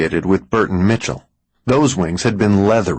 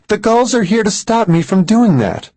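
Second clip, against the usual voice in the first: high, urgent and louder; frightened insistence; 12 semitones higher, 3 dB louder than usual. Acted. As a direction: press it out, scared. Frightened woman insists on danger.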